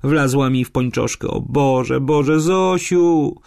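put-on high girl's voice rising to a drawn-out wail; whimper, then wail for help; tearful fright. A narrator's reading. Lonia, wasp in her stocking, calls Zosia in tearful fright. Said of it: "Z oczu jej płynęły łzy".

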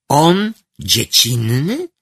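offended disbelief. Acted. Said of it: "skamieniał z obrazy".

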